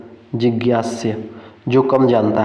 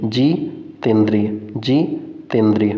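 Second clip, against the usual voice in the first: "जितेंद्रिय" very slowly, pausing after जि; broken into parts; much slower than usual. Shown as a demonstration. Teacher drills the answer for students, slowly split "जितेंद्रिय". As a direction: split "जितेंद्रिय" into a stretched जि, pause, तेंद्रिय.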